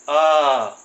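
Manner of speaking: आ drawn out long and loud; long vowel आ. Shown as a demonstration. Teacher demonstrating long आ to students, stretched. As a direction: stretch आ to double length. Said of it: "इसको बोलेंगे".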